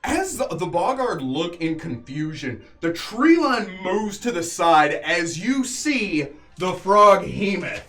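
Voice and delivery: grand, drawn-out monster reveal; game master narration. A narrator's reading. Game master revealing the Frog Hemoth to players, dramatic narration. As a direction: build the big entrance with weighty pauses.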